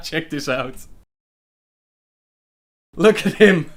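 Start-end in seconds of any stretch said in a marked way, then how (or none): none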